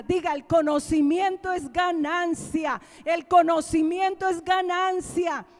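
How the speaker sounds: loud, drawn-out proclamation of scripture; impassioned preaching. Real, not acted. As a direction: hammer each key word home to the congregation. Preaches scripture at full force. (predicando con fuerza, a viva voz)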